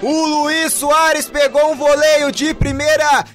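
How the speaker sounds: roaring goal call, drawn-out and high; explosive goal commentary. Real, not acted. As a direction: scream the goal with full joy. Goal scored, narrator erupts.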